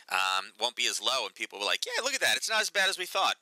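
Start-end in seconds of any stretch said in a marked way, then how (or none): 1.8-3.4 much higher, chirpy mock cheer; mock relief. Imitating relieved traders after a surprise number, high and chirpy. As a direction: ham up the crowd's relief.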